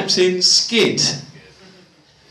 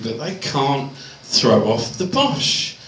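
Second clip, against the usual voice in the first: normal talking voice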